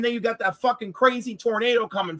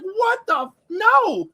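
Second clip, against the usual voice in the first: mock outraged; 9 semitones higher, slower than usual. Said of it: "she's like".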